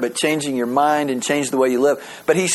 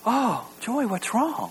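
concerned friend; 7 semitones higher, 7 dB quieter, slower than usual. Retold or imitated. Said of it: "somebody go".

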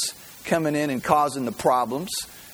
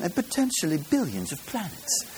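lightly put-on British accent, higher and softer; 4 semitones higher, 5 dB quieter than usual. Watson reporting the night sky to Holmes, mock British accent. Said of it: "Should I do it in a British accent?".

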